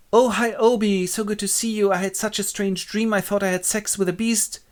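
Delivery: put-on high, girlish-pitched gushing greeting; waking Titania. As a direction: chatter high as a freshly woken queen. Titania wakes up, greets Oberon.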